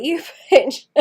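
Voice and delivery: laughing through a fond insult; calling the cat a fond name while laughing; laughing mock insult. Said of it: "I was like".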